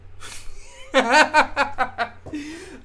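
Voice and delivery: big burst of real laughter, high; loud laugh. Real, not acted. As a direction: laugh out loud at your own joke. Laughs at own fart joke.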